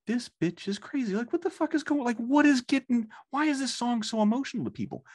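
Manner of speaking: what on earth is going on here; baffled disbelief. Retold or imitated. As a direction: voice climbing in total disbelief. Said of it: "I'm like".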